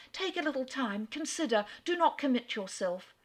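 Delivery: high, urgent coaxing; eager plea. A narrator's reading. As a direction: hurry to hold him back. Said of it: "she eagerly cried".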